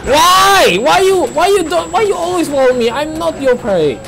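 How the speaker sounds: high, loud protest at the monster; exasperated outcry. Real, not acted. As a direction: wail "why me" at your pursuer. Player protests at chasing monster.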